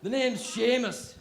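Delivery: loud, high hammed-up Scottish brogue; Scottish accent. Scottish interviewer introducing himself, in a broad Scottish accent. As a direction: boom it out like a jolly Scottish interviewer. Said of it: "the guy interviewing me is Scottish".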